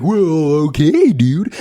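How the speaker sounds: a hint of a dopey cartoon moose; slow, dim cartoon-moose reply; Bullwinkle voice. Answering a friend's invite, a light Bullwinkle voice. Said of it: "I talked to, like, Rocky or Bullwinkle".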